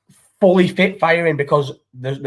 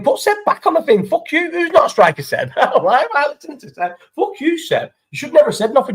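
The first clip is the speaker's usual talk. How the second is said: mock outrage; 10 semitones higher than usual. Streamer to chatter Seb, mock-indignant high-pitched outburst. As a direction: pretend fury, squeaky and playful.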